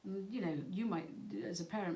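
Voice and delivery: usual speaking voice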